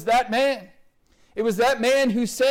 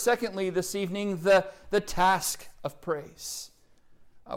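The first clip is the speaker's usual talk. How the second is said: usual speaking voice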